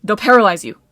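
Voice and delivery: loud, forceful alert; urgent warning. A narrator's reading. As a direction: warn them of danger, loudly. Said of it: "Jason warned".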